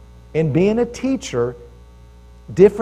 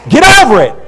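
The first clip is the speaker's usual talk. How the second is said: sharp, much louder and higher rebuke; blunt command; 8 semitones higher, 14 dB louder, faster than usual. Retold or imitated. Jesus cutting the preacher off, a loud sharp command. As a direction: snap it out as a firm order. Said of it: "It was one of those things".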